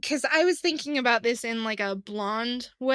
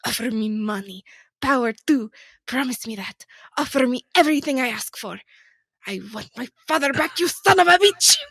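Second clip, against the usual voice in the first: put-on mild Spanish accent, louder in spots; a touch of Spanish accent; 6 dB louder than usual. Cohost finishing the Inigo Montoya scene in a mild Spanish accent. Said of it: "I'm gonna do an accent".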